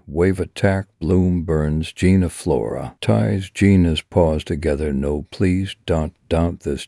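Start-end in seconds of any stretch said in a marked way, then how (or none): none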